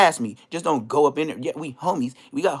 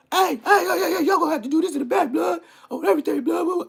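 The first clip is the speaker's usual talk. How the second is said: high, fast imitation of a guard breaking it up; yelling guard; 13 semitones higher than usual. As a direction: mimic a guard shouting at inmates, pitched way up. Officer breaking up a fight.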